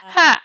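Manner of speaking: mocking laugh bark. Villain's mocking outburst.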